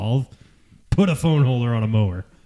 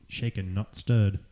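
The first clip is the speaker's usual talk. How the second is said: mock 007 line; 3 semitones lower, 6 dB quieter, slower than usual. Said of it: "I feel like I should say that like, like double oh seven".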